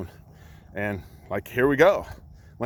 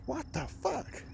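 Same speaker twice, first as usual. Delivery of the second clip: high, much softer what the fuck; hushed high exclamation; 13 semitones higher, 13 dB quieter, slower than usual. Real, not acted. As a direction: breathe it out high in disbelief. Exclaims at experience.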